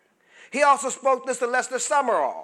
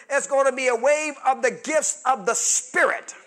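loud, high, fervent sermon voice; preaching at full force; 5 semitones higher, 7 dB louder than usual. Acted. Preacher retelling Wigglesworth's prophecy to the congregation, loud fervent preaching. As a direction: preach it out loud to stir the room.

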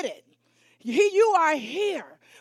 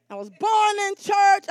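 "born in church" called out high; loud, emphatic retelling; exasperated emphasis; 4 semitones higher, 4 dB louder than usual. Retold or imitated. Repeats it as exasperated preacher's kid.

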